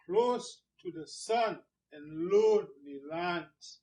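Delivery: flowing beat on "Close", "sun", "lonely", "lands"; rhythmic stress. Shown as a demonstration. Teacher showing there's a rhythm, stress on "Close", "sun", "lonely", "lands". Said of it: "See, there's a rhythm there".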